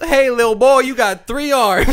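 high, loud hammy carny voice; carnival showman. Retold or imitated. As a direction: ham up a sleazy showman.

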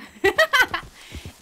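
high, loud burst of laughter; short laugh. Real, not acted. Player joking about leaving him in the snow, amused laugh. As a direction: laugh out at your own mean idea.

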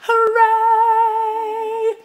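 high, loud celebratory cheer. Real, not acted. Vlogger cheering her fortieth blog, loud and high. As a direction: throw a big hooray.

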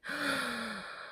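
a quiet, breathy intake of wonder; soft gasp. Real, not acted. Unboxing the doll, a hushed gasp of wonder. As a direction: catch your breath softly. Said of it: "all the gasping".

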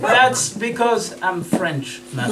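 put-on slow, low silly French accent. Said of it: "speaking in this silly French accent".

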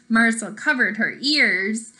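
plain reading voice

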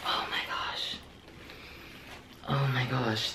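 whispered gasp of delight; awed whisper. Real, not acted. Opening the Chanel bag, to viewers, an awed whisper. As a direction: hush it as the bag appears.

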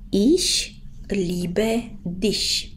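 "Ich liebe dich" spoken very slowly, word by word; slow model sentence. Shown as a demonstration. Teacher slowly models "Ich liebe dich" for students. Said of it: "cum facem o propoziție".

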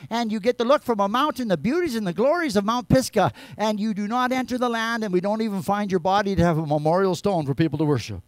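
high, loud, urgent sermon cry; preaching at full force. Acted. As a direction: press the point on Moses hard. Preaching Moses' judgment.

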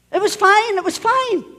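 high, loud, hasty reassurance; imitated anxious child. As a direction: mimic a child answering Dad in a hurry. Children hastily answer stern father.

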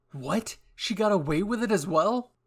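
high, loud disbelief; incredulous. Acted. Narrator shocked at a nickname, high loud incredulity. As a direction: blurt it out, astonished.

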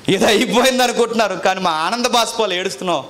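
high, loud, fervent preacher's cry; impassioned preaching. Acted. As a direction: preach the tears of joy with full force. Tears of joy testimony.

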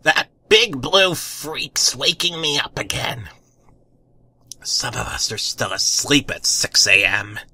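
gruff, grumpy neighbor voice; a hint of a cranky old woman. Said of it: "The woman gruffly poked a finger".

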